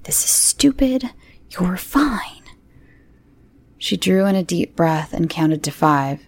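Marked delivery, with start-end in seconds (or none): 0.0-2.4 high unvoiced whisper. A narrator's reading. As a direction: breathe it to calm yourself. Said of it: "she whispered".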